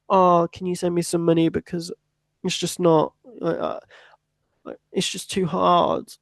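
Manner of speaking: high, whiny plea to mum and dad; whiny pleading child. Retold or imitated. Student mock-pleading with parents for money, whiny and high. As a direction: beg like a broke kid asking for cash.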